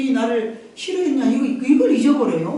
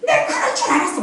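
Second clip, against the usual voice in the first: nagging mother; 13 semitones higher than usual. Retold or imitated. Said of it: "막 이러면서".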